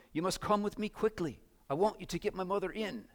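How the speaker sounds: put-on high, urgent plea of a little girl; anxious girl. A narrator's reading. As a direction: read as a girl anxiously pressing for help. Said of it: "she went on anxiously".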